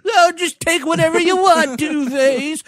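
ridiculous high, loud impression; over-the-top panicky surrender; Pat Hingle. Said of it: "It's my ridiculous Pat Hingle impression".

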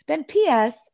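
ordinary delivery

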